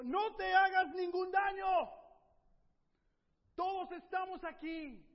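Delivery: grita fuerte y alto, despacio; grito. Acted. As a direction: gritarle al carcelero que no se haga daño. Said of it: "Pablo le gritó". (Pablo grita al carcelero, fuerte y lento)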